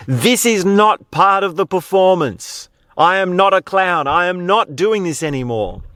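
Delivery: high, emphatic retold protest; exasperated clown. Clown stripping off his costume before the crowd, exasperated protest. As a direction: act out insisting, pitched well up.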